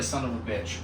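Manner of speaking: very soft, low defeated mutter; muttered curse. Pretend. Comedian muttering in defeat after his daughter rejects him. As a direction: mutter it under your breath.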